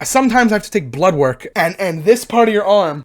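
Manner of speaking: ordinary talk, nothing marked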